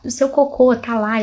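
ordinary delivery

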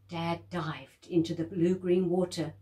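ordinary delivery

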